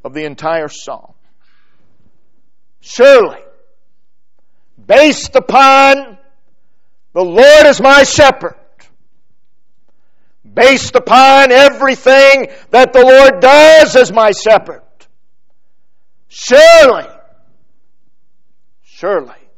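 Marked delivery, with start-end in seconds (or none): 2.8-14.8 high, loud pulpit proclamation; full-force preaching. Acted. 16.3-17.1 surely proclaimed high and loud; surely cried out like a certainty; confident surely. Shown as a demonstration. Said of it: "It tells us the mood, the, the tone". 18.8-19.5 surely murmured softly in wonder. Shown as a demonstration. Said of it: "It tells us the mood, the, the tone".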